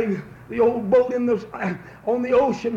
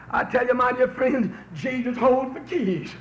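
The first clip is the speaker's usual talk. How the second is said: ordinary delivery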